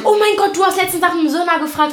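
high-pitched, worked-up exclamation; exasperated outburst. Real, not acted. Complaining about the friend's odd beer choice, exasperated outburst. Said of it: "da bin ich schon ausgerastet".

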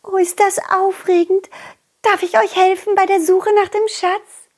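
put-on eager butterfly girl.

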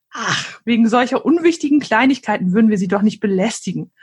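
usual speaking voice

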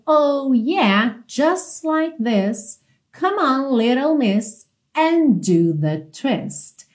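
slow English, words linked; slow model lyrics. Shown as a demonstration. Teacher modelling lyrics for students, slow and linked. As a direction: draw out "just like this" with no T in "just".

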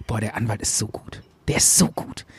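low, soft, half-whispered admiration; hushed awe. Retold or imitated. Dreamer privately marvelling at the lawyer, hushed awe. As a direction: murmur it to yourself, deeply impressed. Retold awed thought about lawyer.

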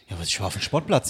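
low, soft dad voice; imitated Hessian father. Father explaining to his son where he was, low imitated Hessian. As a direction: answer as your dad, low and quiet. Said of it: "andere Personen auf Hessisch nachmachst".

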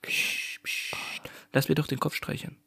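hushed shushing, voice dropped to a whisper; mock soothing whisper. Host pretending to cradle co-host, hushed mock whisper. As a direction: shush him gently like a parent, in jest.

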